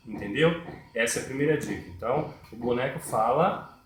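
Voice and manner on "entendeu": usual speaking voice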